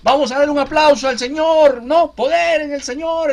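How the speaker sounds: mock hype preacher, high and very loud; rally the congregation like a showman; loud, high-pitched imitation of whipping up emotion; crowd-rousing preacher. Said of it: "de repente los emociono".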